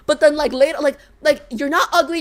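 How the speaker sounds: usual speaking voice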